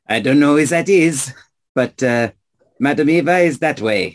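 put-on explaining in a French accent; French-accented local giving directions. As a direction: lay out the route with a French accent. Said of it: "You speak in weird accents".

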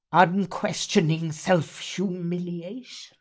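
slow, intense reading with long pauses; Miss Havisham. Acted. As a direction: read it as a bitter, obsessed jilted bride. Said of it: "Miss Havisham is Dickens".

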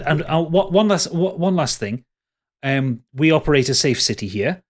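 normal talking voice

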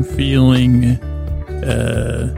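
ordinary talk

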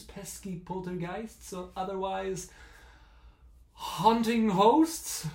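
slow, rising eerie delivery; spooky host voice. Acted. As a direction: tease it like a haunted emcee.

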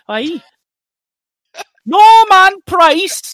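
very high, loud, slow Welsh yell; a drawn-out, laughing cartoon shout of a name; Fireman Sam shout. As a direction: call out across the village. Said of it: "'cause the".

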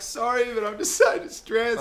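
mock distressed whine. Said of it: "You're like".

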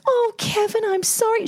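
high, gushing apology; imitated movie mum. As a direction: coo an apology to your son. Voicing the Home Alone mother.

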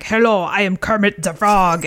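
ordinary talk, nothing marked

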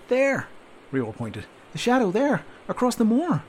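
high, loud excited call; urgent pointing. Acted. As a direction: point it out, thrilled. Soldier pointing out shadow urgently.

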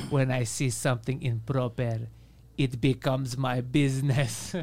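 slow, low, deliberate pronouncement; imitating mom. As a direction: lay down the rule like a stern mother. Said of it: "And my mom, being my mom".

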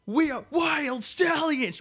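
high, slow rock shout; rock star yell. Retold or imitated. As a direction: belt it like a hair metal singer. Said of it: "I'm like, freaking".

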